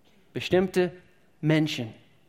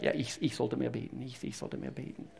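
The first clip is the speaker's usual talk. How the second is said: mock mumble; 3 semitones lower, 10 dB quieter than usual.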